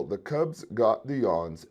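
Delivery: usual speaking voice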